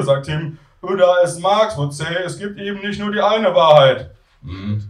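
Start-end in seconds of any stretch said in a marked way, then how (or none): 0.8-4.1 raised voice of a pontificating student. Acted. Student lecturing flatmates on Marx, high character voice.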